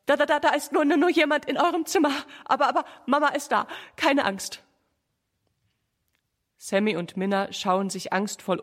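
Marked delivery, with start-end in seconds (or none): 0.0-4.6 höher und schneller, mit vielen Wiederholungen; ängstlich stotternd. A narrator's reading. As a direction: vor Angst stottern und sich überhaupt beruhigen wollen. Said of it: "stottert Mama". (verängstigtes Stammeln, hastig und höher)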